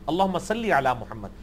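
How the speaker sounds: low recitation, «صلی» as "sal-lee"; correct Arabic salli. Shown as a demonstration. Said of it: "معروف عربی میں یہ صلی لفظ ہے".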